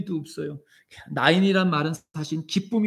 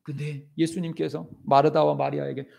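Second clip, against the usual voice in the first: impassioned sermon, rising and falling; preaching; 3 semitones higher, 4 dB louder than usual. Acted. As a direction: preach it with fervor to the congregation. (열정적인 설교)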